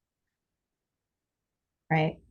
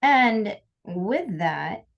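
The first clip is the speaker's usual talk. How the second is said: ordinary delivery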